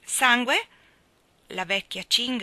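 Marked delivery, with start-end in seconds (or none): 0.0-0.7 deaf surprise. A narrator's reading. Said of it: "Hono era quasi stata costretta a gridare".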